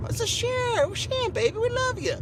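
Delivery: very high, sweet-talking voice; playful high-pitched affection; mock loving. Mock-sweet address to audience.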